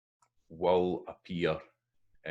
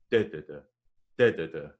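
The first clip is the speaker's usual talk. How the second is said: first da hit hardest, the rest light; stress rhythm; 7 dB louder than usual. Shown as a demonstration. Teacher beating out the stress pattern of medicine. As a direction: tap out the stress on the first beat. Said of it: "You hear the stress at that".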